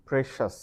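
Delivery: "precious" as "PRESH-us"; a single "shus" syllable ending "precious"; correct pronunciation. Shown as a demonstration. Teacher showing how it has to be, correct pronunciation. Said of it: "it has to be".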